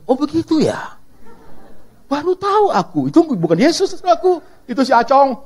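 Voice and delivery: high, laughing mock surprise; mock clueless Jesus. Preacher mocking a Jesus who knows nothing, amused.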